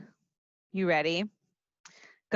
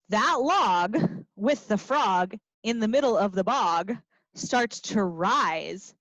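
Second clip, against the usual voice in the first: ordinary delivery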